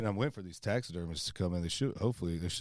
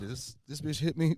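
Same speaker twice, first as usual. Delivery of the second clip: high-pitched, acted-out alarm; mock alarm; 8 semitones higher than usual. Hunter checking himself after a gunshot, mock alarm. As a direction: replay a moment of panic, half joking.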